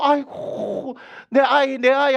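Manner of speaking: high imitated wail over a lost child; mock wailing. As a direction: act out a grieving parent's wail, hammed-up. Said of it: "하면서 막 그렇게 울-, 울면서".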